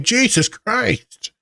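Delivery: high, slow, drawn-out exasperation; exasperated mock groan. Therapist to joking client, slow exasperated high groan. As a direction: stretch it out, fed up and amused. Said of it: "I was like".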